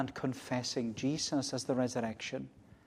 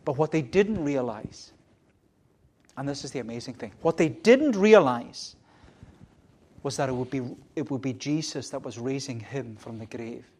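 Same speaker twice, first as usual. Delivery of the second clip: ordinary talk, nothing marked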